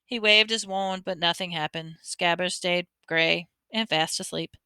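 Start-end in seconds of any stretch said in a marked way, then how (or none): none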